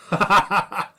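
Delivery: big booming laugh, high and loud; hearty orc laugh. Acted. Orc laughing before he greets the party.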